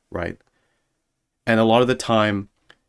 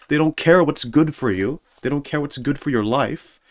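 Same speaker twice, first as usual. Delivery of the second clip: usual speaking voice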